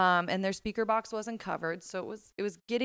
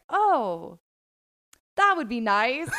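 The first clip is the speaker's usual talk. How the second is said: awkward laughing reply; 10 semitones higher, 9 dB louder, slower than usual. Retold or imitated. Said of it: "the only thing I can think of".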